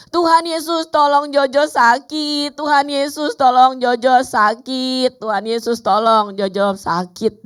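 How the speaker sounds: put-on high, loud child's wail, drawn out; stretched, pleading mock cry at high pitch; imitated crying yell. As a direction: mimic a child crying out in pain, easing off gradually. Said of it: "Dia nangis, dia teriak apa?".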